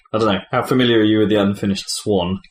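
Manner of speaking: ordinary delivery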